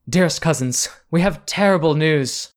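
high and rising to a loud wail on murdered; theatrical grief, building; distraught lady. Acted. Noblewoman tells the cousins of the murder, building to a loud wail. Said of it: "rushes towards you".

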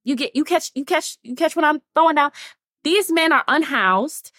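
ordinary talk, nothing marked

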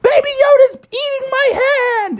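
high, loud yelp for help; panicked Froggy. Acted. Bitten Froggy shouts to the teacher, high and loud. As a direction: scream for the teacher in mock panic.

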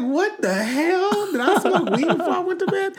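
high, incredulous and near laughter; mock disbelief. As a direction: exclaim in amused, high-pitched disbelief. Retelling her disbelief, laughing.

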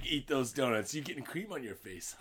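low soft mocking coax; quiet taunting. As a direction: tease him under your breath. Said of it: "He's like, he's like".

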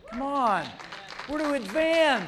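high, loud, driving sermon shout; rally the congregation at the top of your voice; full-force preaching. Real, not acted. Preacher urging the church not to shrink back, shouted exhortation.

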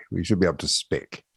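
muttered repeat to himself; quiet aside. Real, not acted. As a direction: say it again softly, half to yourself.

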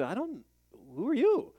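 a mock-baffled divine voice, rising sharply; puzzled God the Father. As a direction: pitch climbs high and loud on the question. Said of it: "Father can look at you and say".

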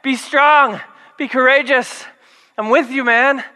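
frustrated coach energy, hammed-up; God as exasperated parent to Joshua; mock exasperated yell. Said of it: "God's like".

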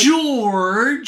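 high, drawn-out exclamation; playful high-pitched scolding; mock protest. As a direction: call him out in a high, stretched cry. Mock protest at retold George Lucas remark.

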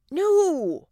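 high, loud drawn-out no; urgent protest. Acted. Wife stopping husband calling an ambulance, loud protest. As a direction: blurt a panicked objection.

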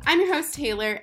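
ordinary talk, nothing marked

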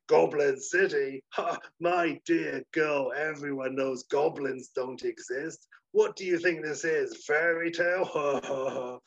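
very slow, condescending sloth; slow mocking sloth. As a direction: scoff slowly at the girl.

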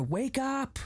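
high-pitched mock yell, no real volume; retold yell. Host retelling Harrow yelling at her, mock yell. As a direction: imitate a yell by jumping up in pitch. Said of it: "he yells at her".

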